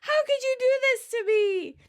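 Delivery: high-pitched upset cry; mock yelling. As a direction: act out an upset bride wailing. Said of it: "Emily from yelling".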